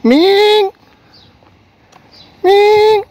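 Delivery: high, loud call across the yard. Real, not acted. Woman calls her cat Ming from afar, loud high call. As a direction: call the cat's name out loud.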